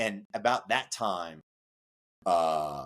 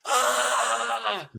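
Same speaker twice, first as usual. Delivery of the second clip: loud cry; 4 dB louder than usual. Retold or imitated. Recruit's loudest cry in sand.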